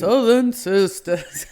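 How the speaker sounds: slow Southern drawl, laughing through it; Southern accent. Pretend. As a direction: give it a hammy Southern twang. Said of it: "my Southern accent needs severe work".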